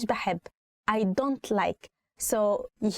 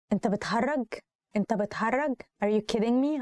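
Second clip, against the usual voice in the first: ordinary talk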